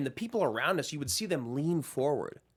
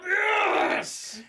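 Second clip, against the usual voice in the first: much higher triumphant shout; fist pump cheer; 4 dB louder than usual. Retold or imitated. Viewer cheering at the big moment, triumphant shout. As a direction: yell it like your team just scored. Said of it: "And you're like".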